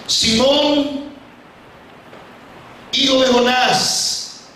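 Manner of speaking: high, drawn-out call, word by word; solemn questioning. Acted. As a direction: put a solemn question slowly, pitched high. Jesus questions Peter before everyone.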